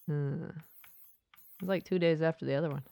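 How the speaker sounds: usual speaking voice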